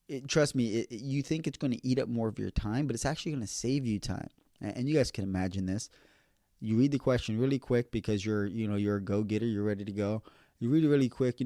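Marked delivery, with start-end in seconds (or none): none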